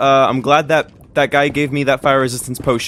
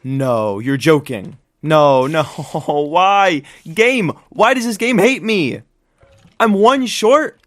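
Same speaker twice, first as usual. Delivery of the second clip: exasperated disbelief; slower than usual. Real, not acted. One eye short, frustrated at game.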